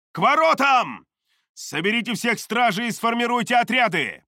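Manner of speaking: high, loud battle orders; commanding shout. A narrator's reading. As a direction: bark out the orders. Archmage orders troops to gates.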